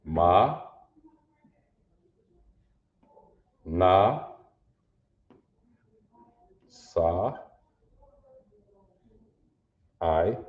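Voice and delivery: Ma, Na, Sa, I each said alone with long pauses; letter sounds. Shown as a demonstration. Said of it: "say 'em out loud".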